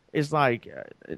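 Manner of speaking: usual speaking voice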